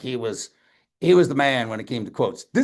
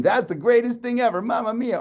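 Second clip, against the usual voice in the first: much higher, thrilled mamma mia; delighted exclamation; 11 semitones higher than usual. Shown as a demonstration. Speaker showing the happy meaning of mamma mia, delighted. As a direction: exclaim mamma mia like it's the greatest thing ever. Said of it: "it can mean".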